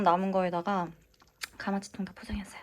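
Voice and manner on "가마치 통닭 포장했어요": whispering. Real, not acted. Whispered late-night haul.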